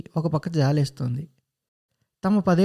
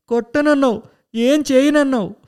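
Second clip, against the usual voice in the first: higher, louder sobbing accusation; loud crying protest; 7 semitones higher, 4 dB louder than usual. Acted. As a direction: wail it through tears. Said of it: "అంటున్నాడు పెద్దగా". (ఏడుస్తూ గట్టిగా నిలదీయడం)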